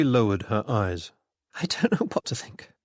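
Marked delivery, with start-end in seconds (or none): 1.6-2.7 higher, with a touch of a tremble; understated tremble, pitched up; trembling voice. A narrator's reading. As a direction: say it with a slight waver in the voice. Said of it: "there was a tremble in her voice".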